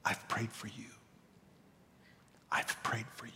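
soft breathy whisper; Jesus whispering. Acted. Jesus looking at Peter after his denial, whisper. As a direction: whisper it tenderly, barely aloud. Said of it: "looked at him and said".

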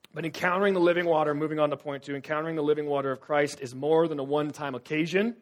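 big sermon voice for point two; emphatic preaching. Acted. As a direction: drive the point home.

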